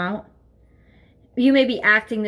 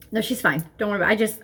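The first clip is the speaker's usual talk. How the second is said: usual speaking voice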